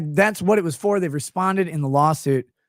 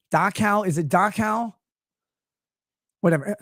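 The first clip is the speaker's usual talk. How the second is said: usual speaking voice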